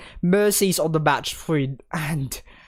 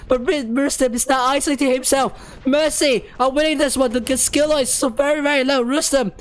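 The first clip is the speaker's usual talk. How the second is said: breathless high caster hype; live play-by-play; 7 semitones higher than usual. Acted. Live casting of a fast game.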